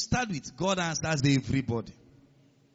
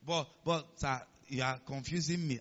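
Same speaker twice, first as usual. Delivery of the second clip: quieter, halting objection; confused listener; 5 semitones lower, 9 dB quieter than usual. Acted. Congregant objecting to the preacher's claim, mildly confused. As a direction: interrupt softly, stumbling over the start.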